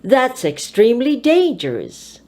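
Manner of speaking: "dangerous" stands above "extremely"; stress on the adjective. Shown as a demonstration. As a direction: voice high on "dangerous". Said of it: "put your voice high on the adjective".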